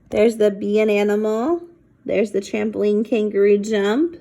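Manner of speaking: plain reading voice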